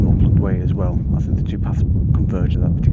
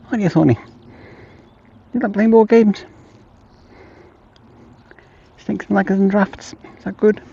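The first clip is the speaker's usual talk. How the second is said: talking to the dog; 10 semitones higher, 4 dB louder than usual. Real, not acted. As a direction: coo at the puppy, much higher and brighter. Owner coos at her dogs.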